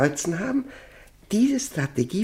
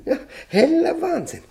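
manic laugh; 14 semitones higher, 3 dB louder than usual. Acted. Madman laughing at his own madness.

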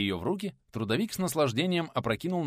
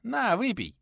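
high, cheerful peasant voice; hearty offer; 13 semitones higher, slower than usual. Acted. Nikodim urging the teacher to drink, high and cheerful. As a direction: push the cup at him cheerfully.

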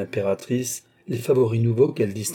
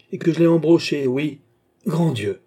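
raised, louder outburst; agitated; 6 semitones higher, 6 dB louder than usual. A narrator's reading. Son revealing the duel to his father, raised. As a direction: fling it at him.

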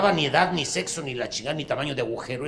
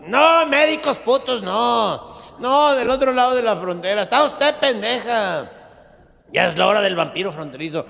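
Cruz yelling; 8 semitones higher, 6 dB louder than usual. Acted. Cruz shouting back.

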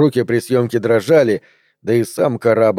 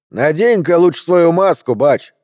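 higher-pitched mocking jibe; lazy taunt; 6 semitones higher, 3 dB louder than usual. A narrator's reading. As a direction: needle him with a smirk. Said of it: "лениво усмехнулся Эдли Маккин". (насмешливая подначка с ухмылкой)

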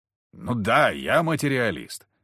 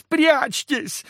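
higher and louder, near a cry; louder urging; 4 dB louder than usual. A narrator's reading. Unseen woman repeats her warning, higher and louder. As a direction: repeat it more desperately. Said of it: "вторила ему невидимая и неосязаемая женщина".

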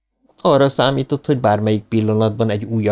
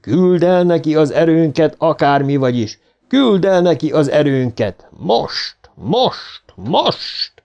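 strange deep shout; 6 semitones higher, slower than usual. A narrator's reading. Bill channels the group's power to Mike, strange deep shout. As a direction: call out the power, building to the final nows. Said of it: "kiáltotta Bill ugyanazon a furcsa, mély hangon".

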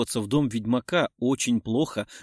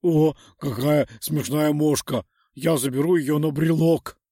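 booming troll voice; 3 semitones higher, 3 dB louder than usual. A narrator's reading. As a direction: drone it out like a dopey troll. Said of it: "прогудел тролль".

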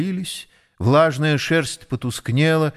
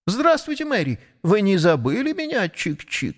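high-pitched little sparrow asking eagerly; chirping sparrow; 8 semitones higher than usual. A narrator's reading. Sparrow greets his old friend Mary, high and chirpy. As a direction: pipe up like a small bird. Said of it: "спросил воробей".

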